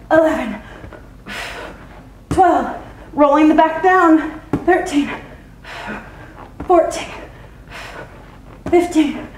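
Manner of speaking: counting reps mid-crunch, straining; loud, effortful counting through the burn; doing sit-ups. Real, not acted.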